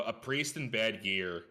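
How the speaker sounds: normal talking voice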